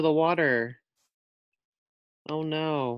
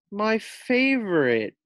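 whimper; 8 semitones higher, 3 dB louder, slower than usual. Acted. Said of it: "Pearl whimpers".

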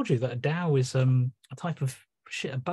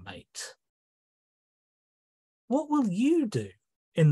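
higher, indignant sea god interrogating humans; Neptune; 7 semitones higher than usual. Acted. The sea interrogating humanity, higher indignant Neptune. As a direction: accuse them with rising hurt. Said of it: "in the voice of Neptune".

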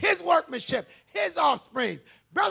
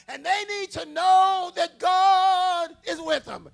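soaring, much louder sermon peak; preaching climax; 4 semitones higher, 9 dB louder than usual. Real, not acted. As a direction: lift the whole church with it.

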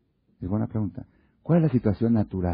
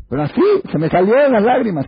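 loud, very high tearful wail; mock teary wife; 12 semitones higher, 8 dB louder than usual. Wife telling husband the tears just came, hammed-up wail.

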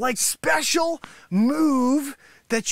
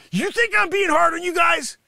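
ordinary talk, nothing marked

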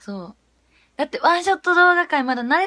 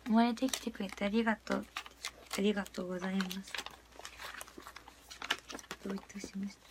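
much softer murmured reading; 4 semitones lower, 13 dB quieter, faster than usual. Real, not acted. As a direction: murmur it to yourself. Reading sign messages softly.

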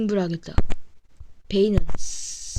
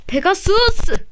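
anime hero battle cry; 6 semitones higher than usual. Acted. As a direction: call the Pegasus attack. Character battle cry in game.

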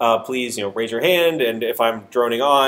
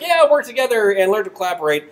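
cheery mock quote; 8 semitones higher than usual. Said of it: "the pair program where it's like".

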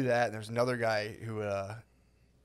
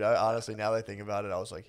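usual speaking voice

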